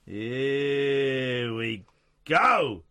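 very slow stretched here we go; drawn-out. Pretend. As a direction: stretch every word out long. Repeating phrase in drawn-out voice.

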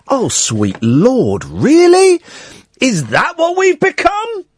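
high-pitched mock dismay; incredulous. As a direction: sound scandalised by it. Mock outrage at apology.

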